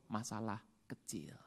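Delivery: soft, slow whisper; whispered aside. Real, not acted. Preacher whispering an aside to the congregation. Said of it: "whispered aside".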